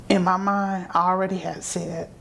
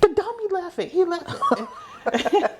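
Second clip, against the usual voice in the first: high laughing, slow at the end; laughing retort; 12 semitones higher, slower than usual. Retold or imitated. Daughter telling her mom the dummy left it, laughing. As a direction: giggle it out high.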